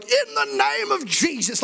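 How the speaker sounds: high, slow, hammed-up prayer cry; mock fervent prayer. Laying hands on Helen with hammed-up prayer. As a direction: belt it out like a zealous prayer warrior.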